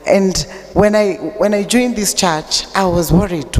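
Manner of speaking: usual speaking voice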